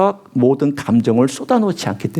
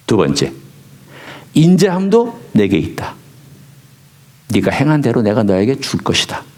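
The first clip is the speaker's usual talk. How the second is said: a hint of a lower, calm voice of God; 6 semitones lower than usual. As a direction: speak as God gently reassuring David. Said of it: "성령께서 말씀해 주셨습니다". (다윗을 안심시키는 하나님의 낮고 차분한 음성)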